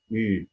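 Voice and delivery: يو as rounded French "ü"; French letter U. Shown as a demonstration. Teacher demonstrating lip position, rounded French "ü". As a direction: say يو with lips pushed out, a tight "ü". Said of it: "لازم الشفه اللي تحت دي تكون مفروده".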